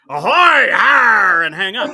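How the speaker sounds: loud pirate "ahoy, har"; pirate greeting. Retold or imitated. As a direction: bellow it like a pirate on the phone.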